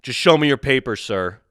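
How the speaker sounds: mock authority, punched loud; imitated border agent. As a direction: imitate an officious agent giving an order. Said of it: "What does the border agent say?".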